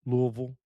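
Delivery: Louisville squeezed to "LOO-vul"; slurred local pronunciation. Shown as a demonstration. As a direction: run Louisville together as "LOO-vul", middle swallowed. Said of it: "however you wanna pronounce it".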